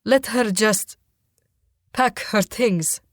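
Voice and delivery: halting request, broken by a pause; halting plea. A narrator's reading. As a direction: ask haltingly, slowing at the end. Father pleads for a last delay.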